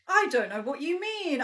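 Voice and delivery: normal talking voice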